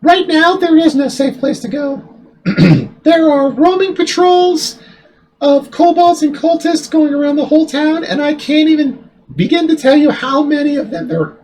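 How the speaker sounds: high falsetto woman's voice; man voicing a woman in falsetto; fake girl voice. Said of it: "fake girl voice".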